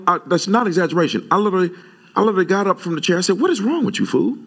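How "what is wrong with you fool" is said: put-on high, rising retort at the boy; preacher retells scolding his barber, high and incredulous; retold outburst. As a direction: snap it out high, in disbelief. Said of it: "I'm not exaggerating".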